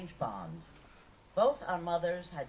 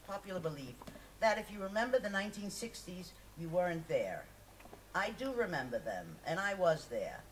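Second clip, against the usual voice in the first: usual speaking voice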